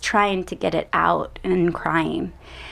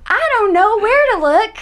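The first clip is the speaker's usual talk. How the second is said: put-on higher, louder Texas accent; 13 semitones higher, 6 dB louder than usual. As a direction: keep the Texas accent going. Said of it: "my Texas accent's coming out".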